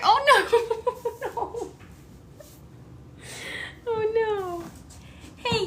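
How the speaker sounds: high, laughing coos at a kitten; laughing pet talk. Real, not acted. Owner laughing as a kitten squeezes under furniture. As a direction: soothe the startled kitten through laughter.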